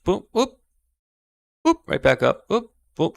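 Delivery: high, springy imitated bounce sounds; playful boop noises. Host replaying a stumble on the stairs, playful boops. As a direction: pop out little cartoon boops.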